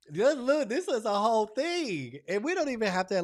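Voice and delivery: high, loud and insistent; excited exclaiming. Real, not acted. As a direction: hype it up at full volume. Hyped explanation after the laugh.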